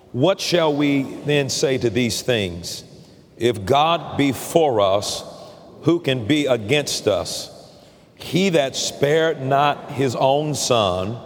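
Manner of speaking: slow, measured scripture reading; reading scripture aloud. Shown as a demonstration. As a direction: read it out together, slowly and steadily. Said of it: "let's read verse thirty-one and thirty-two out loud together".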